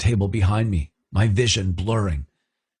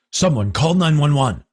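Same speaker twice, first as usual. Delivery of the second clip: urgent shout; 8 semitones higher, 4 dB louder than usual. Acted. Calling out in an emergency.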